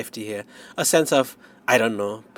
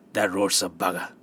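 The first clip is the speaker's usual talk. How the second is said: lightly voiced Maine storekeeper, gruff warning in Maine accent; thick Maine accent; 3 semitones lower, 4 dB quieter than usual. A narrator's reading. Store owner warns traveller about the road, in a thick Maine accent. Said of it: "such a thick Maine accent".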